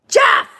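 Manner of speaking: sharp gasp then name called; shocked gasp. Real, not acted. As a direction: gasp in mock outrage. Outraged gasp at son.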